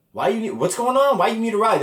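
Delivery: high, baffled questioning; retold high-pitched disbelief; incredulous. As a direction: ask like you can't believe it. Retelling his confused reply to parents.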